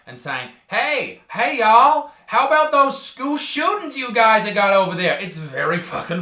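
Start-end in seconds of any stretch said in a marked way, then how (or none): 0.7-5.2 put-on high, loud caricature of an American accent; mock American accent. Australian doing an impression of Americans, mock American accent. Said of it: "trying to do an impression of Americans".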